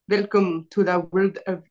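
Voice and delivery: attempted Russian accent, breaking off. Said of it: "can you do a Russian accent".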